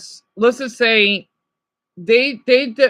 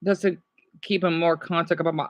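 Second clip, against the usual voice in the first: ordinary talk, nothing marked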